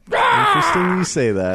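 high, loud theatrical cry; dramatic high shout. Pretend. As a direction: shout it like a melodrama. Repeats line, second take dramatic.

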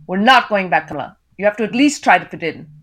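put-on firm husband with an Indian accent; Indian father's voice. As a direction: lay down the law to your wife. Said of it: "I read in my characters' voices".

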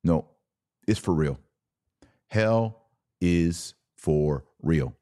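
normal talking voice

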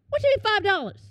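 high-pitched, offended incredulity; indignant disbelief. Retold or imitated. Undercover officer offered five dollars, high indignant disbelief. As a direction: squeak it out, affronted by the offer. Acted shock at insultingly low offer.

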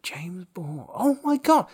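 hushed name, then a high excited squeal; stunned whisper bursting into a delighted shriek; starstruck gasp. Retold or imitated. Meeting a famous band member at a party, starstruck. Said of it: "I was like".

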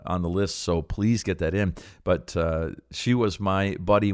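normal talking voice

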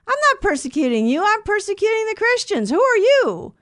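indignant, high-pitched protest; Saul. Acted.

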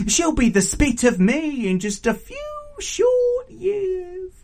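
high, loud teasing climax, then brisk farewell; raucous, gleeful auntie. Acted. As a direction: rub it in with stretched, high words.

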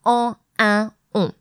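"en, un, on" as pure French nasal vowels. Shown as a demonstration. French teacher showing learners typical nasal sounds, nasal vowels sounded singly. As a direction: sound out "en", "un", "on" through the nose, one at a time. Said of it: "the nasals".

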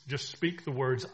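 ordinary talk, nothing marked

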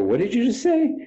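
jumps way up high in shocked disbelief; mock astonished squeak; incredulous disbelief. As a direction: ask it like you can't believe your ears. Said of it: "And I would go".

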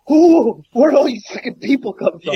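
a sudden high "Ooh" of shock, then a rushed question; startled surprise. Retold or imitated. Guys turning round to find a crowd, high startled shock. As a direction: play the guy who turns and finds himself outnumbered.

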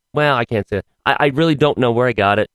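usual speaking voice

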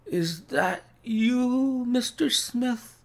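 slow, very high strained voice; feeble sick man. A narrator's reading. Sick Holmes to his visitor, feeble high voice. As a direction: drag it out weakly, pitched high. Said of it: "Holmes whispered".

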